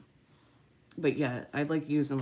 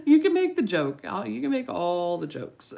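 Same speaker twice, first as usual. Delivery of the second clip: laughing; 8 semitones higher, 8 dB louder than usual. Real, not acted. Laughing at her dogs.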